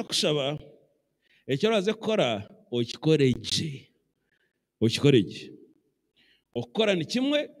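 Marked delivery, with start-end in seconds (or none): 2.7-3.9 low, slow, deliberate stress on okikore; emphatic instruction. Real, not acted. Pastor stressing to do work well, low and slow. 4.8-5.5 low, falling, deliberate okikore gye; firm repetition. Real, not acted. Pastor repeating the command firmly, low and falling.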